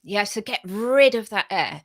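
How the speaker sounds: usual speaking voice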